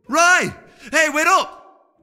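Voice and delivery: Cranberry racing after Rai, calling loudly. A narrator's reading. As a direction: shout after them down the street.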